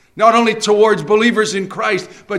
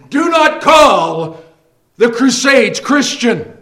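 slow, louder stern command; stern warning; 3 semitones higher, 7 dB louder, slower than usual. Acted. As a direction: warn the flock, slow and firm. Preacher warning congregation.

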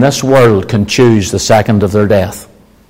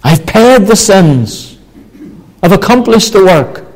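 raised, measured cry of triumph, mildly voiced; conqueror's cry; 7 semitones higher than usual. Preacher quoting Christ at his death, restrained conqueror's cry. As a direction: declare it as a victor, pitched up without shouting. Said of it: "he shouted with the voice of a conqueror".

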